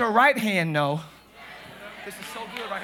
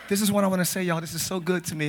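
ordinary delivery

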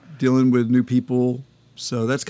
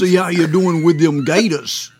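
put-on Louisiana accent; 4 semitones higher, 4 dB louder than usual. Co-host quotes a skit judge threatening gators, in a Louisiana accent. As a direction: play a swamp-country judge with a Louisiana accent. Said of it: "they all do this Louisiana accent".

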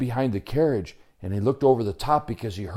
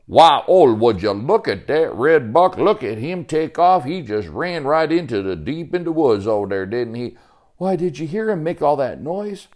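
higher, excited country character voice; hearty backwoods Sebastian, amazed; 6 semitones higher, 4 dB louder than usual. Acted. Sebastian marvels at the fleeing red buck, excited. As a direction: marvel like a folksy woodsman.

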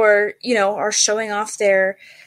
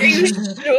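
high, loud-ish incredulous question through laughter; retold amazed double-check; laughing disbelief; 6 semitones higher, 4 dB louder than usual. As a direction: act astonished by the offer, laughing. Acts out disbelief at offer.

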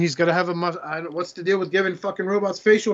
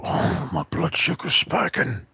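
mock old man; slower than usual. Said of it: "voiced quote".

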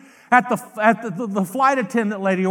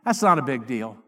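acted low, offhand brush-off; dismissive shrug; 8 semitones lower than usual. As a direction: drop the voice low and wave it away. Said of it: "you know what your answer is".

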